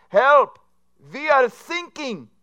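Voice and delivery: put-on loud, high panicked German accent, sinking for thinking; German sinking crew. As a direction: shout for help in a German accent. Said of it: "an S O S message comes over and says".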